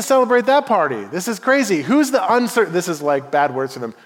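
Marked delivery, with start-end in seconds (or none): none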